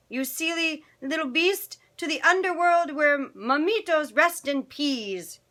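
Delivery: high, teasing storybook dog voice; Chihuahua pack. Acted. Puchitos correcting Scipito, high-pitched mock scolding.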